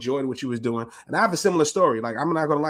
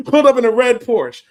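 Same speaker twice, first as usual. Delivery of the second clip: "Pulled up in a red Porsche" repeated louder and higher through a laugh; laughing excited repeat; 7 semitones higher, 10 dB louder than usual. Shown as a demonstration. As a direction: relish the flashy detail, laughing. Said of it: "He pulled up in a red Porsche".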